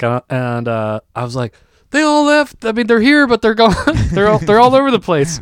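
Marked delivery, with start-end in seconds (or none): 1.9-5.4 high-pitched, excited explaining; hurriedly filling in the friend who returns; flustered report. Acted.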